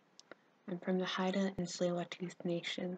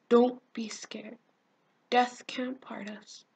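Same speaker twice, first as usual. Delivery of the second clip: slow, understated grief in a slightly raised voice; dying reassurance; 5 semitones higher, 6 dB louder, slower than usual. Acted. Dying friend comforts her, slow and understated grief. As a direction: comfort her slowly through restrained pain. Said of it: "laughing and crying at the same time".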